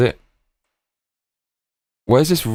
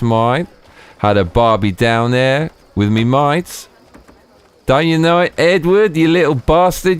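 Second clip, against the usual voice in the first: put-on Australian accent; 7 semitones higher, slower than usual. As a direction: lay on a thick Aussie twang. Streamer answers chat in character.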